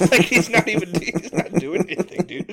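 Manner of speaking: words squeezed out through laughter; laughing. Real, not acted. Laughing while retelling.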